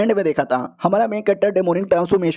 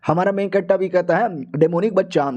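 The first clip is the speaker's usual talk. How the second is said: usual speaking voice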